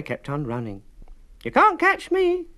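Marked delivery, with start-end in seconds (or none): 1.4-2.5 very high, loud, proud little voice; high loud bragging cookie; proud boast. A narrator's reading. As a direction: crow with pride. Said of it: "said the little gingerbread boy".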